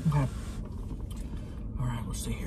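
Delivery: soft whispered aside; whispering. Real, not acted. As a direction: whisper it to yourself. Quiet aside while eating.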